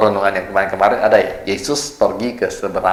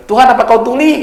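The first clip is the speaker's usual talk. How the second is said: high and louder, fed-up cry; mock exasperated shout; 13 semitones higher, 6 dB louder than usual. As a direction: shout up at a God who seems deaf. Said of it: "kita perlu teriak-teriak". (teriakan kesal yang dibuat-buat, tinggi dan lebih keras)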